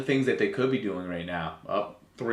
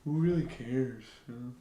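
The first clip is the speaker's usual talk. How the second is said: careless, shrugging player voice, a touch softer; lazily mock the players who don't care; mocking bored players; 5 dB quieter than usual. Streamer voicing the players answering their coach, careless shrug. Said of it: "this is probably them".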